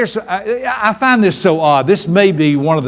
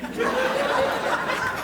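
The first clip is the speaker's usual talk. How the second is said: imitated daughter laugh; 11 dB quieter than usual. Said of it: "they look at me and go".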